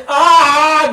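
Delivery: loud, high mock scream; grito fingido. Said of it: "gritar, güey". (grito fingido, agudo y fuerte)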